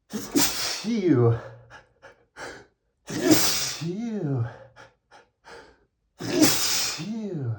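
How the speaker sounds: big blasting sneezes; loud sneeze. Acted. Sneezing fit video.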